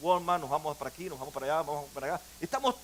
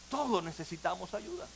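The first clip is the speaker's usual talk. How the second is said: much softer, hushed, intimate restatement; soft repetition; 4 semitones lower, 14 dB quieter than usual. Real, not acted. Preacher quietly repeating to his congregation, hushed. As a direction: say it gently, almost to yourself.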